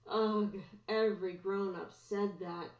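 usual speaking voice

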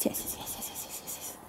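hushed, rapid whisper; whispered yeses. Real, not acted. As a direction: breathe it soothingly. Soothing excited baby.

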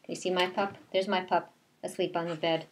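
ordinary talk

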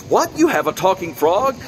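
high, shocked disbelief; astonished. Acted. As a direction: leap up in amazement. Tourist shocked frog talks.

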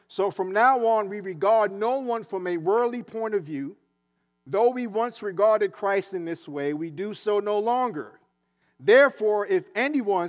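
loud, measured scripture read; preacher reading the word aloud. Acted. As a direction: proclaim the verses to the church. Reads scripture aloud with force.

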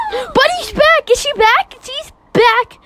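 high, squealing excitement; excited disbelief. Acted. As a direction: blurt it out in a high, amazed voice. Acts out Bunny's sudden return.

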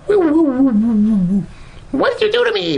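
very high, slow, tiny bewildered voice; shrunken man. Pretend. Grown man hit by a shrink ray, squeaking in dismay.

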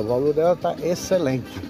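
ordinary talk, nothing marked

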